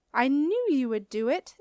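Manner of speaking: loud, high burst of delight; triumphant, can't hold it in; thrilled teacher proven right; excited exclaim. A narrator's reading. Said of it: "exclaimed Marcia excitedly".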